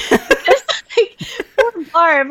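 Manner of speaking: high, slowed words through laughter; real helpless amusement; laughing while talking. Real, not acted. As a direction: struggle to talk through the giggles. Laughing over friend's misfortune.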